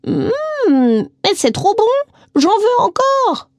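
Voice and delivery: très aigu et très lent, extasiée; étire les mots, au comble du plaisir; exclamation ravie. A narrator's reading. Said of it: "s'exclama-t-elle". (la vieille dame réclame encore de la tarte, très aiguë et lente)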